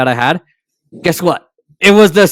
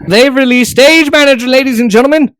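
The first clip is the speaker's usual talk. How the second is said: mock grand announcement; 10 semitones higher, 4 dB louder than usual. Mock announcement of new feature.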